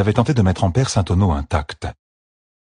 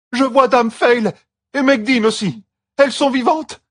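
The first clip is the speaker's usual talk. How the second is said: much higher and louder joyful cry; cried out; 3 dB louder than usual. A narrator's reading. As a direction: shout the discovery. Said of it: "cria la femme". (cri joyeux, bien plus haut et plus fort)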